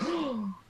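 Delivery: a small sharp intake of breath; a quiet shocked gasp; subtle gasp. Retold or imitated. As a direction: gasp softly at the screen. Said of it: "subtle gasps".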